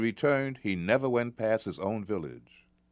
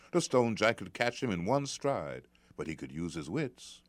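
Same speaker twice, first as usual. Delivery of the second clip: plain reading voice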